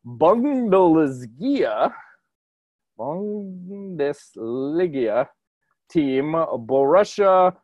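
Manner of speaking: "Bundesliga" and "Borussia" sounded out very slowly, stretched; botched foreign pronunciation. Real, not acted. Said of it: "not gonna pronounce any of these right".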